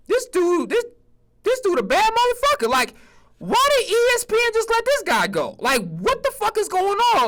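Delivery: incredulous high-pitched rant; excited disbelief. Real, not acted. Excited rant about Merrill Hodge.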